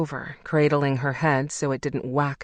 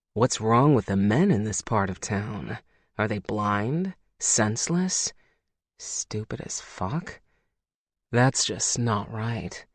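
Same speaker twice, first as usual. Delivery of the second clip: lower, gruff male lover, indignant on her behalf; Logan's voice; 5 semitones lower, 3 dB quieter than usual. A narrator's reading. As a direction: scoff at the men who ignored her, in a low male voice.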